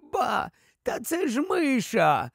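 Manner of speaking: high, delighted exclamation of discovery; amused exclamation. A narrator's reading. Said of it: "вигукнув він".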